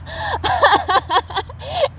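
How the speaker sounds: high squirrel laugh, falling back; laughing hard. A narrator's reading. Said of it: "laughed so hard".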